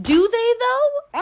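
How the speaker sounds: incredulous mock question; scoffing at the character's reasoning; skeptical. Real, not acted. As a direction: pitch it way up, loud and disbelieving. Doubting the book's claim.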